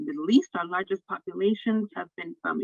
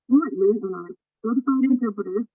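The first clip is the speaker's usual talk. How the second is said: normal talking voice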